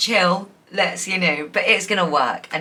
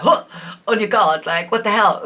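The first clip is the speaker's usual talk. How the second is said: louder mock-startled reaction; 3 semitones higher, 5 dB louder than usual. Reader playing the viewer as love leaps forward, mock-startled. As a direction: act taken aback, on your guard.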